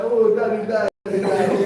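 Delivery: mocking the crying children at a funeral. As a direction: imitate a grieving child at the funeral, hammed up. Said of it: "You see two person crying".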